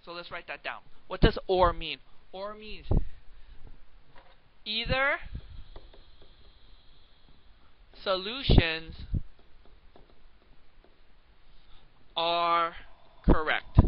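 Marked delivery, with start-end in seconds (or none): 2.3-13.8 slow, loud dictation, word by word; dictating definition. Shown as a demonstration. Teacher dictating to students, slow loud deliberate words. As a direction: spell it out for note-taking, each word apart. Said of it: "teacher dictates definition slowly".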